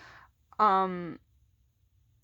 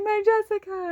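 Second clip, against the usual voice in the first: high, loud delighted cry, imitated; happy greeting; 12 semitones higher, 5 dB louder than usual. Assistant greeting her favourite Jessica, very happy. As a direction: imitate an overjoyed welcome. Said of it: "she was so happy to see me".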